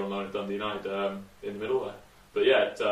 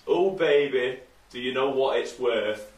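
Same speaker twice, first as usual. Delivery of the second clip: put-on louder, slow Yorkshire accent line; 4 semitones higher, 6 dB louder, slower than usual. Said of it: "give us your best Yorkshire accent".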